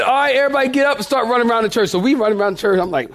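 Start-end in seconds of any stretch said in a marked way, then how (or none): 0.0-1.9 hurried, higher pastor's call; rally the congregation to get running; pastor giving orders. Retold or imitated. Pastor to congregation starting the run, lightly acted command. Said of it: "And so he said".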